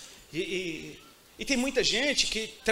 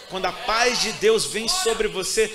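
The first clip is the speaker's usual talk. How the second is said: preaching shout; 8 dB louder, slower than usual. Real, not acted.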